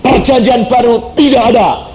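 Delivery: scornful father, hammed-up high; dismissive father. (meniru ayah yang meremehkan)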